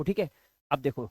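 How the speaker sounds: normal talking voice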